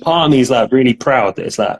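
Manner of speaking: usual speaking voice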